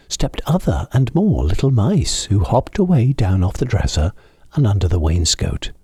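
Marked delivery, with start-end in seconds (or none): none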